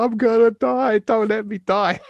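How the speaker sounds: high, loud pleading wail, played for laughs; retold panicky begging yell; mock drunken scream. Drunk on his first night in the flat, begging not to die, in a high mock scream. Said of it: "I was screaming out".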